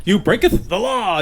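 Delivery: hammed-up Old English movie accent; olde-worlde English accent, played for laughs; mock English accent. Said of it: "with the English accents".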